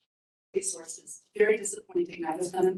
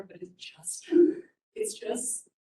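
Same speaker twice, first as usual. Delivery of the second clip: emotional; 5 semitones higher, slower than usual. Real, not acted. Resident apologising for emotion at meeting, choked up. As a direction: voice dropping almost to nothing. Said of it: "I'm a little emotional there".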